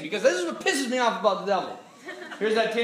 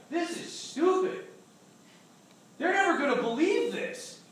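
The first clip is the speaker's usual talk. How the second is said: accusing inner voices; 8 semitones higher than usual. Retold or imitated. Intrusive thoughts heckling the speaker as he types, mocking. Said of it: "these thoughts just started coming in".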